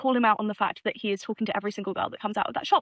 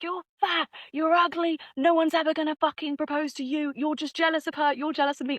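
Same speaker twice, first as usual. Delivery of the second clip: retold angry yelling; 5 semitones higher than usual. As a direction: imitate a man hurling insults, without shouting. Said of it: "yells at this girl".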